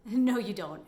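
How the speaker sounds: a nurse cutting the patient off, loudly; loud flat refusal; loud dismissive no. Retold or imitated. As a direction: overrule her, sharp and loud. Nurse flatly overrules her wish.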